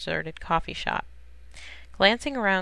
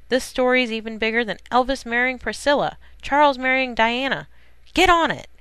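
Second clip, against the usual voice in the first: louder, higher, building to a punched command; excited order; 7 semitones higher, 7 dB louder than usual. A narrator's reading. Editor ordering reporters onto the big story, loud and urgent. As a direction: push the order harder until it peaks.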